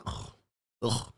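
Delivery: soft, disgusted groan; a quiet grossed-out ugh. Retold or imitated. Boyfriend sees mayonnaise lips, quiet disgusted groan. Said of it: "You look at her like".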